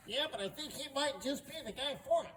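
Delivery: high, loud voice of the other guard; second guard. Acted. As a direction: pipe up high in disagreement. Second guard answers the first.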